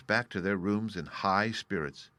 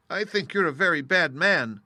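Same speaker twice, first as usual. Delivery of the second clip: put-on girl's voice, higher and louder in reproach; Dorothy scolding; 7 semitones higher, 7 dB louder than usual. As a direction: tell the wizard off, a little girl's indignation. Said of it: "said Dorothy".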